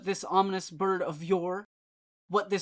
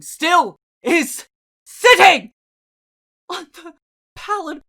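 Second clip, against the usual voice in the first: swelling outcry; 9 semitones higher, 13 dB louder than usual. A narrator's reading. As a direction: stretch it out in anguish.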